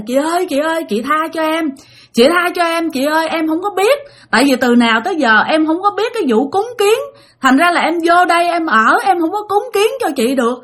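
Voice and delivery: high, meek begging; silent pleading. Retold or imitated. Terrified sleeper begging the ghost in their head, high and pleading. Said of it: "muốn nói ra để mà năn nỉ".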